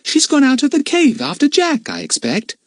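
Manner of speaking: put-on high, irritable girl's voice; cross Dinah. A narrator's reading. Dinah grumbling crossly at Lucy Ann. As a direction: grumble it out, half asleep. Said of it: "even crosser".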